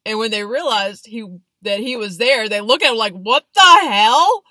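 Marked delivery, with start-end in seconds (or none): 3.1-4.4 loud, high, drawn-out mock disbelief; stunned outrage. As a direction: shout it slowly, acting utterly baffled by a stranger. Acted startled couple seeing officer.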